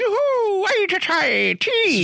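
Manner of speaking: high, squeaky leprechaun; hammed-up silly leprechaun call. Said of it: "He goes".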